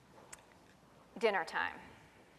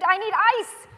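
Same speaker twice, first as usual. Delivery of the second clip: put-on loud high kid's scream; 8 semitones higher, 9 dB louder than usual. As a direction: scream it like a hurt kid. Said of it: "he screamed".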